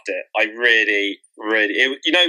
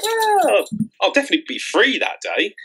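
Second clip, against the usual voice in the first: mock eager; 10 semitones higher than usual. Mock eager reply to date.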